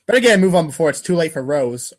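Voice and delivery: ordinary delivery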